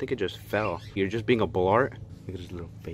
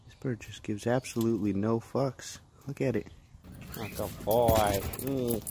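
usual speaking voice

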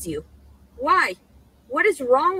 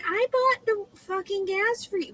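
higher, put-on mocking mom voice; mother's voice; 8 semitones higher than usual. Daughter imitating her mother's gas complaint, higher mocking. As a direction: sneer it like her nagging mother. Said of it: "She's like".

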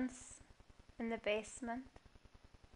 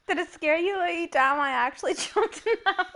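laughing; 6 semitones higher, 6 dB louder than usual. Real, not acted. After jump scare.

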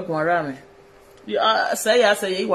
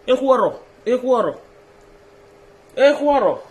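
calling out; 5 semitones higher, 5 dB louder than usual. Real, not acted. Calling out slogan word.

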